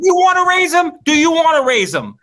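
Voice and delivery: loud, very high mock challenge; indignant yell. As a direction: yell it like an outraged parent at a cop. Mock confronting a cop.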